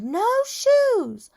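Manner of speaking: put-on very high toddler tantrum scream. A narrator's reading. Boy fighting the shoe salesman, high screaming. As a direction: scream it like a small child refusing. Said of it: "He screamed".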